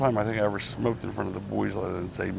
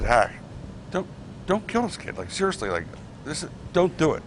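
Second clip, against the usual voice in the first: pleading; 6 semitones higher than usual. Retold or imitated. Begging Sandman to spare the kid.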